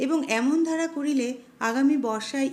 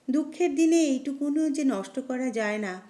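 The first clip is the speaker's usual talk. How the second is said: ordinary delivery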